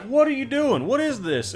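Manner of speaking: high startled question; shocked governor. Retold or imitated. Governor seeing the prison chain, high and startled. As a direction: jump up in pitch, taken aback. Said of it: "is like".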